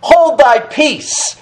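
slow, high-pitched rebuke; Jesus. Acted. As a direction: stretch each word into a solemn command. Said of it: "And Jesus rebuked him".

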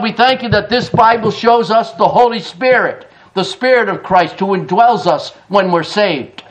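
normal talking voice

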